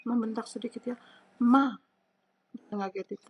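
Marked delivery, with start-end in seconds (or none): 1.5-1.8 Ma falling fourth tone. Shown as a demonstration. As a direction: snap "Ma" straight down. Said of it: "Nadanya seperti itu, turun".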